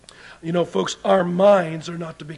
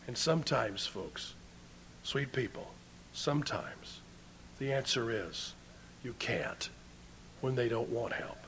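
much lower and softer, nearly whispered at the end; hushed, gentle aside; 7 semitones lower, 15 dB quieter than usual. Real, not acted. Preacher consoling the congregation about those refusing help, hushed. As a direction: drop right down to a hush for the hard truth.

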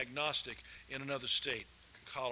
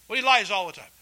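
retold loud insistence; 6 semitones higher, 12 dB louder, faster than usual. Friend insists loudly.

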